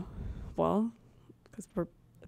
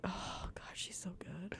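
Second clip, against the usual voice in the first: whispering; 20 dB quieter than usual. Retold or imitated. Whispered imagined thought.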